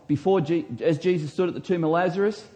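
ordinary talk, nothing marked

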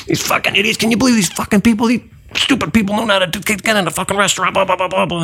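high-pitched, scornful busboy tirade; imitated belittling rant. Busboy belittling tourists to the table, mock ranting. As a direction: berate them as the fed-up busboy. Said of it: "he just starts belittling these people".

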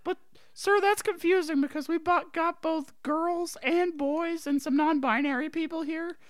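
high, eager schoolkid; curious little student asking teacher; child student voice. Acted. Plays a pupil in class skit.

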